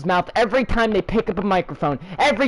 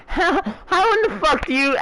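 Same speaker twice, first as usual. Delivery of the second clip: laughing disbelief; 8 semitones higher than usual. Real, not acted. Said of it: "laughs in disbelief".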